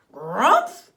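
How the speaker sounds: loud startled bear grunt; choking bear grumph. A narrator's reading. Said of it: "she cried".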